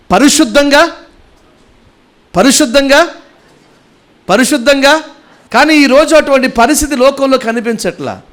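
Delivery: high, insistent preaching voice; fervent preaching. Acted. Pastor urging the church to keep the holy day, fervent and high. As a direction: drive the commandment home from the pulpit. Preacher raises voice on the sabbath commandment.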